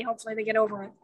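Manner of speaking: ordinary delivery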